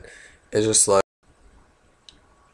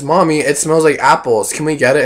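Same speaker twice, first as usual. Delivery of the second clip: put-on higher, louder whiny kid; 5 semitones higher, 6 dB louder than usual. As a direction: beg like an excited little kid. Kid voiced in story.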